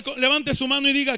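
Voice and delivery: ordinary delivery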